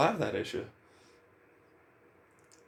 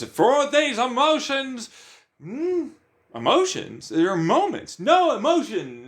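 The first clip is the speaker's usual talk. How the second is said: mock inner debate; 7 semitones higher, 4 dB louder than usual. Arguing with himself about emotions.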